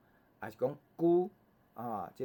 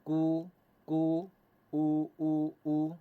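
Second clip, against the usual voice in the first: higher, sustained model chant for repetition; teacher's reading drill; 4 semitones higher, 4 dB louder, much slower than usual. Shown as a demonstration. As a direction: lead the class in even, held vowels. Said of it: "咱做伙来念一下哈".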